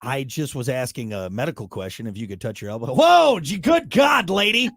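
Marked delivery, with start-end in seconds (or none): 2.9-4.7 loud, high hammed-up whoa; mock shock. Host teasing his co-host with mock shocked loud whoa. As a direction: act bowled over, playing it up.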